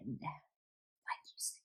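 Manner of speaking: slow hushed whisper; ominous whisper. Acted. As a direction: let the words hang like a bad omen. Ominous echo of his words.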